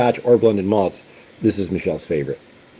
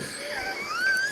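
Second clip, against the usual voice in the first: high laugh; 11 dB quieter than usual. Real, not acted. Laughs at his own quote.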